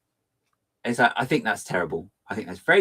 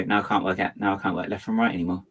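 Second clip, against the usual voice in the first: usual speaking voice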